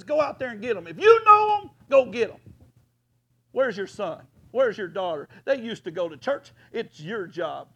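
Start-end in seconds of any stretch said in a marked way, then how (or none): 0.0-2.4 rising to a loud shout, higher; preacher shouting James's command. Acted. Preaching James's call to the church, rising shout. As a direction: proclaim it like a rallying cry. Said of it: "he says".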